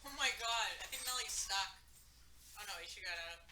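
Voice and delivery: high, soft and breathy; hushed high play-by-play. Real, not acted. Narrating dogs play fighting.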